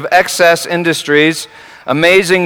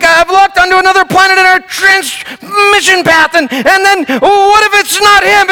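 mock panicked technician; 4 dB louder than usual. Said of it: "she said something like".

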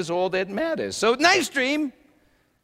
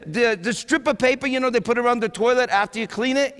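ordinary delivery

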